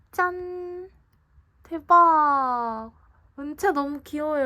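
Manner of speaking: high, loud, drawn-out fan squeal; excited gushing. Real, not acted. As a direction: gush over something adorable. Gushing over cute photo card reveal. (감격해서 신나게 외치듯)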